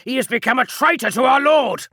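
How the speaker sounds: loud high accusing shout; brand him a traitor. Acted. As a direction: Zancator accuses Kelefon, shouting.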